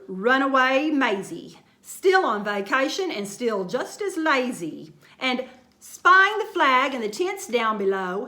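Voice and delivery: usual speaking voice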